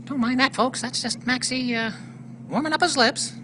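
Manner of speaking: ordinary talk, nothing marked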